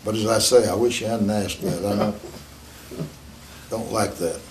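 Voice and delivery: ordinary talk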